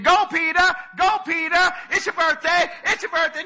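high, loud sing-song party chant; mock cheering. Disciples imagined cheering Peter on, high loud sing-song chant. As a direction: cheer him on like a birthday crowd. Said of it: "I don't think they were standing up saying".

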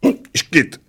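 high, sudden acted hiccup; high little hiccup; hiccup burst. A narrator's reading. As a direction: splutter out a hiccup. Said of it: "vyprskl maličký".

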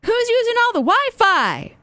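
high-pitched household yell; acted yell. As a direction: holler an annoyed complaint through the house. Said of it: "nobody's yelling".

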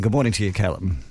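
ordinary talk, nothing marked

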